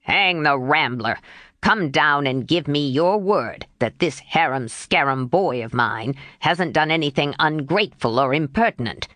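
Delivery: a gruff grandfather demanding answers; gruff old man. Acted. Mister Lawrence voiced.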